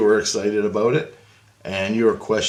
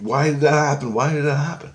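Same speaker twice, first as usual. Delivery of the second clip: higher, mildly panicky retelling; freaked-out friend; 4 semitones higher than usual. Retold or imitated. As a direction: imitate a freaked-out friend questioning it all. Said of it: "he's all freaked".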